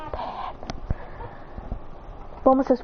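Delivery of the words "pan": hushed whisper of a secret. Acted. Whispering the baby's name to Bulma. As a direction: whisper the name so no one hears. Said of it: "Why are you whispering?".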